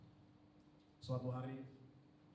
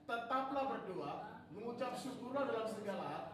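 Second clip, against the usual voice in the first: reading scripture aloud; 6 semitones higher than usual. Real, not acted. Preacher leading the church in reading the verse aloud. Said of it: "Kita baca yuk. Dua tiga".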